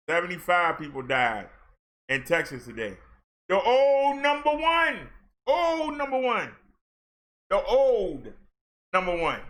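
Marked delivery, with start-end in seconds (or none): 3.5-6.5 raised and slowed, savouring the phrase; pitched high, slowed, mock-ceremonial; dramatic emphasis. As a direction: drag out the old title for effect. Dramatic callback to former leader.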